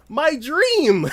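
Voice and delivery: high, loud hammed-up delight; thrilled exclamation. Viewer getting the wished-for race, thrilled. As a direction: squeal it as if overjoyed.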